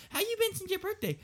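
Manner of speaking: high mock quote; mocking question. Mimicking someone asking publicly on a story, high-pitched. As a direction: squeak it out mockingly.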